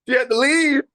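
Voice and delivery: loud, high-pitched exclamation of disbelief; incredulous outburst. Retold or imitated. Actor recalls the exec walking out on him, incredulous outburst. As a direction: blurt it out, voice shooting way up. Repeats it in mock outrage, laughing.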